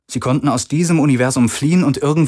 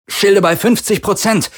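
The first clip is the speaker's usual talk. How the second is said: raised, strained voice over battle din; gritted over the noise; 11 semitones higher, 4 dB louder than usual. A narrator's reading. Pilot straining to be heard over the impacts, gritted shout. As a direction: grind it out loud over the hits. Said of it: "knirschte er über den Lärm der Einschläge".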